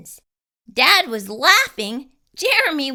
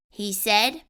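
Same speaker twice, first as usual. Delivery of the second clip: ordinary delivery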